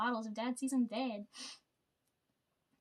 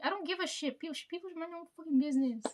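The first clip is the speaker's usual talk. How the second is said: indignant rant; 4 semitones higher, faster than usual. Retold or imitated. Retort to coworker about age.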